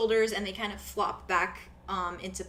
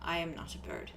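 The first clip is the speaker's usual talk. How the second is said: quiet denial; 3 semitones lower, 8 dB quieter than usual. Acted. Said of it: "he's like".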